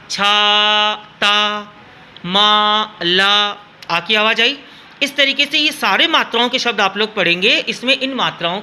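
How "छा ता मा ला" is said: "छा, ता, मा, ला" with the आ held long; long aa sound. Shown as a demonstration. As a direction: lean on the long आ in each syllable. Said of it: "आ की मात्रा के अंदर आ की आवाज़ आती है". ("छा, ता, मा, ला" में आ की आवाज़ लंबी खींचकर)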